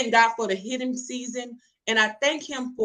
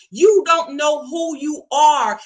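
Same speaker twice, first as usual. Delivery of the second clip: louder, slow, stern correction; stern rebuke; 5 semitones higher, 8 dB louder, slower than usual. Retold or imitated. God correcting her for rushing into marriage, stern. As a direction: lay it down firmly and slowly.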